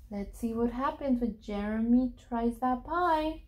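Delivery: hushed and scheming. Acted. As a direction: murmur it with a sneaky grin. Dad's sly thought, much softer.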